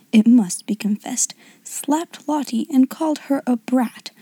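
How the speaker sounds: usual speaking voice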